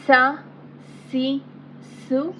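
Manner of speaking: thick Arabic sad: sa, si, su; thick letter. Shown as a demonstration. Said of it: "from Huruf-e Musta'liya".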